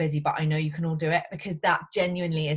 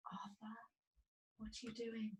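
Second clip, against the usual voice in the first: soft mum to son; 4 semitones higher, 23 dB quieter than usual. Real, not acted. Mum speaking to her son.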